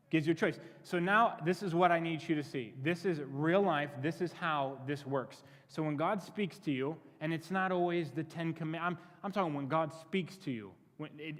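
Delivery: usual speaking voice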